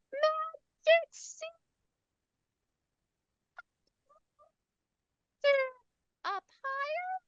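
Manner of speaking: very high, soft, slow squeak; squeaky voice. Shown as a demonstration. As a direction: squeak the strokes like a tiny puppet. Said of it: "we're gonna use a squeaky voice".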